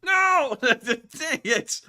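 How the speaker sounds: loud high playful cry; a laughing dismayed shout; surprised outburst. Real, not acted. Loses the naming game.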